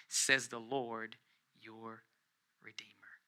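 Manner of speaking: slow, soft close with long pauses; hushed reverence. Acted. Preacher closing the reading from Isaiah, hushed and reverent.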